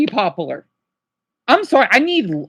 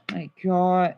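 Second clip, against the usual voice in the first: whispered, heavy sigh of despair; exasperated sigh; 7 semitones lower, 7 dB quieter than usual. Real, not acted. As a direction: breathe it out, fed up and drained. Exasperated streamer sighs.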